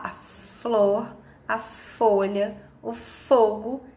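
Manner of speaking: "flor", "folha", "fogo" each with a held f; slow down and lengthen the f opening "flor", "folha", "fogo"; stressed initial sound. Shown as a demonstration. Teacher pointing out the same first sound, f drawn out. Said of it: "têm o mesmo sonzinho".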